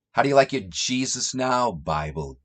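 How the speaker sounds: usual speaking voice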